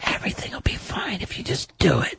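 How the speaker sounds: soft eerie demon whisper; scary demon voice. Pretend. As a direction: whisper like a tempting devil. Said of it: "you're doing the scary demon voice".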